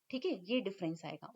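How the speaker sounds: ordinary delivery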